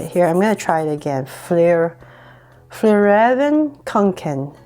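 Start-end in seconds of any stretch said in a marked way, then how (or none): 2.7-4.5 slow, careful Swedish-style accent on Fjärran Könken; Swedish-ish accent. Shown as a demonstration. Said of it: "I don't know why I change my accent".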